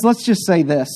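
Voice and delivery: ordinary talk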